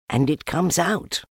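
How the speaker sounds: high small-animal voice; Piglet, marvelling. A narrator's reading. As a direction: pipe it up high.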